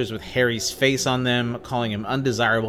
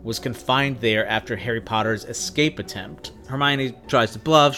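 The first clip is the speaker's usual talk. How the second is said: ordinary talk, nothing marked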